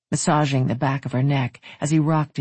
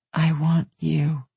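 slow repeat; slower than usual. A narrator's reading. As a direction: draw each word out slowly. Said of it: "Say it again".

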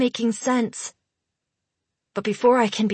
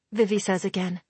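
ordinary delivery

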